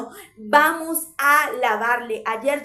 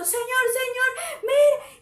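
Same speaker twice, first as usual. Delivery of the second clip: high, wailing mock sobbed prayer; a hammed-up tearful cry of help, much higher; imitated crying plea; 11 semitones higher, slower than usual. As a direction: wail to the Lord like a desperate believer. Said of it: "y el otro".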